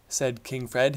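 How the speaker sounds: usual speaking voice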